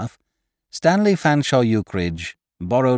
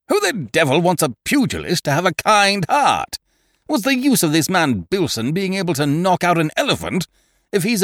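higher, blustering rant; indignant Eucridge; 10 semitones higher, 4 dB louder than usual. Acted. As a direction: bluster about mushy pugilists.

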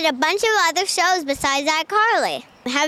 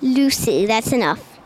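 low attempt at English accent; copied English accent; 8 semitones lower than usual. Shown as a demonstration. Pupil repeating the Narnia line, attempted English accent. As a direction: echo it in a deeper English accent. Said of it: "I like the English accents from that one".

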